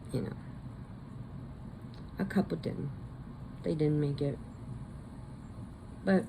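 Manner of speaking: normal talking voice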